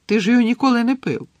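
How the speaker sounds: high, fast, loud retort; rapid high-pitched teasing objection; amused contradiction. A narrator's reading. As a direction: pounce on the absurd claim. Amused friend contradicts his claim.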